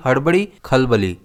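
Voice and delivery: ordinary talk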